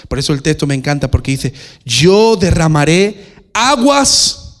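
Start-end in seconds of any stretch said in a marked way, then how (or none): 1.8-4.6 loud, high, long-drawn words; ringing pulpit declaration; triumphant proclamation. Real, not acted. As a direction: proclaim the promise slowly and fully. Preacher proclaims favourite verse.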